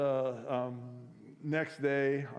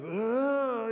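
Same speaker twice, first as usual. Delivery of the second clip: groan; 10 semitones higher, 5 dB louder than usual. Retold or imitated. Son groans under father's scolding.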